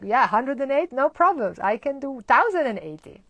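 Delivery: loud, high, gushing boast; mock-eager, showing off about the count; hammed-up keen student; overeager enthusiasm. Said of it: "you see your enthusiasm".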